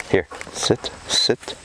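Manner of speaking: hushed whisper of "sit, sit"; whispered command. Real, not acted. Trainer whispering a sit command to her dog. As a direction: breathe the command low and close.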